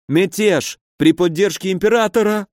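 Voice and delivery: high-pitched, suffering voice; pained moaning. A narrator's reading. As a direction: groan the answer out through pain. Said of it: "простонал фон Бюхель". (стонущий высокий голос)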